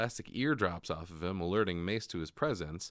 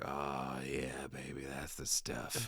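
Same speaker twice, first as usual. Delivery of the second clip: slow, breathy soft whisper of pleasure; lightly voiced creepy corpse whisper; mock whisper; 6 semitones lower, 7 dB quieter, much slower than usual. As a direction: hush it like a creepy treat. Corpse savouring blood, voiced mockingly.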